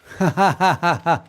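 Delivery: quick amused laugh; short laugh. Real, not acted. Streamer laughing at her own joke, short laugh.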